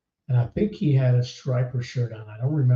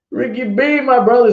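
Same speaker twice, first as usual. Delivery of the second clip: excited shout-out; 10 semitones higher, 7 dB louder than usual. Real, not acted.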